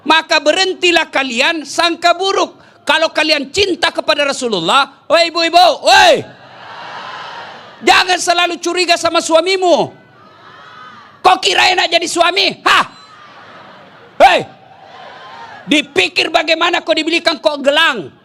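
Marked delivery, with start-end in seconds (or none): 5.1-14.4 preacher shouting a warning; shouting at mothers. Real, not acted. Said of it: "preacher shouts at mothers".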